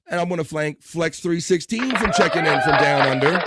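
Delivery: high, loud shout-out; hyped thanks. Real, not acted. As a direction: announce the thanks with rising gusto.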